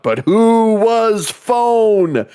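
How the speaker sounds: high, drawn-out mock wail; mock-dramatic. Demanding the caller's identity, hammed-up drama. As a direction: stretch each word way up high, hammed-up.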